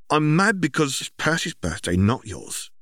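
a touch of sisterly annoyance; sharp sister retort. Acted. Sister scolds brother at party, sharp but restrained. Ginny scolding Ron quietly.

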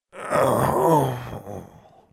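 moaning. Acted. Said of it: "Larry moaned".

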